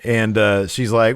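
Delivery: usual speaking voice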